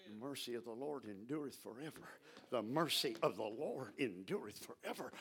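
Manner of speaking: low, soft muttering under the breath. Shown as a demonstration. Preacher showing how to meditate, soft low muttering. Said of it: "You just start mumbling".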